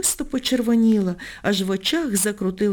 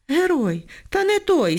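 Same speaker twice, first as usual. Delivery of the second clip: boiling over; 8 semitones higher, slower than usual. A narrator's reading. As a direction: snap back, outraged. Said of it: "скипіла Ніночка".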